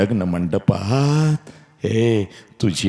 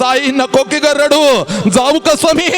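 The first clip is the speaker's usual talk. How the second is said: crying bride; 4 dB louder than usual. Acted.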